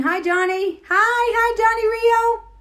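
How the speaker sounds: high, loud delighted squeal; excited greeting. Real, not acted. Greeting Johnny on the live stream, excited squeal. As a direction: call out hello, loud and high.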